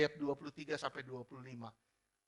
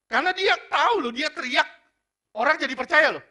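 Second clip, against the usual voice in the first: much higher, much louder preaching climax; impassioned cry; 11 semitones higher, 15 dB louder than usual. Acted. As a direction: shout the revelation to the congregation. Preaching at full force.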